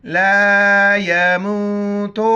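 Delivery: ला यमूतु with a long मू; loud-held, slow ला यमूतु with stretched मू; meem joined to waw. Shown as a demonstration. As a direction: slowly recite ला यमूतु, linking म into a drawn-out oo. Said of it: "मीम को वाव के साथ मिलाएंगे".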